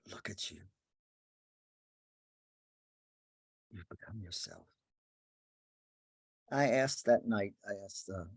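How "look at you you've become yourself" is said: much softer, hushed and reverent; humbly, with awe. Real, not acted. Speaker blessing each listener, softly in awe. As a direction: say it in quiet wonder. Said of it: "I would like to say humbly and with awe".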